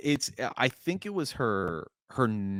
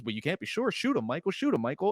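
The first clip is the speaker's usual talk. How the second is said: aggressive; 7 semitones higher than usual. Retold or imitated. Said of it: "she gets aggressive".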